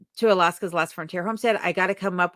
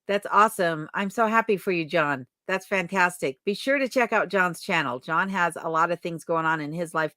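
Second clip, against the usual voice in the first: ordinary delivery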